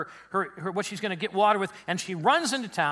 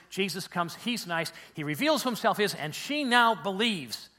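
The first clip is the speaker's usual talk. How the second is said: ordinary talk, nothing marked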